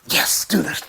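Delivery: breathy whisper to the dog; whispered encouragement. Real, not acted. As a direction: whisper it so he stays focused. Quiet aside to dog.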